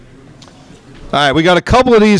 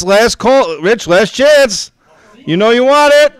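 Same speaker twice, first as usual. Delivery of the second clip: brisk rising auctioneer patter; auctioneer calling bids; 4 semitones higher than usual. Real, not acted. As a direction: drive the bidding up, rallying the room. Live auction bid calling.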